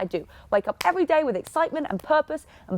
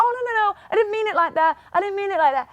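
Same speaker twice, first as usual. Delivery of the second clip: backpedaling; 7 semitones higher, faster than usual. Retold or imitated. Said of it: "they're gonna be like".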